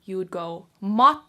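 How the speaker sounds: matto with a long T pause, loud; matto, held T. Shown as a demonstration. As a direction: hold the T in matto. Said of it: "pause on that T a little extra longer".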